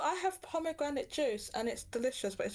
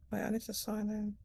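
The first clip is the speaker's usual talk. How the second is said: ordinary delivery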